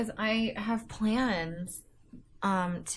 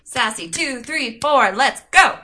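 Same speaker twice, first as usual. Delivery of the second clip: loud, high rallying chant; shouted count-in; 5 semitones higher, 12 dB louder than usual. Pretend. Co-host cheering on the reader, shouted count-in.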